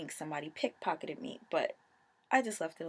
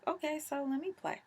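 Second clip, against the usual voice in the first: eager; 9 semitones higher than usual. Retold or imitated. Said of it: "so I was like".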